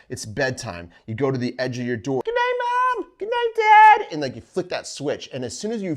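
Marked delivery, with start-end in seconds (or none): 2.2-4.1 put-on high, loud child calling goodnight. Kid calling goodnight to mom and dad, high and loud. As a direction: yell it up the hall like a kid at bedtime.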